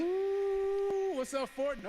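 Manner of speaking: high, loud whoop into a greeting; hyped-up, high-pitched yell; excited shout. Real, not acted. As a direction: holler hello to a huge crowd. Guest's excited greeting to virtual crowd.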